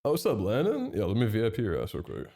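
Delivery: ordinary talk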